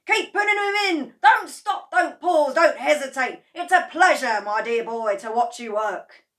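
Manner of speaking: high, loud, gleeful urging; gleeful cheering. A narrator's reading. Mister Cranky urging George, high and loud. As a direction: whoop him on.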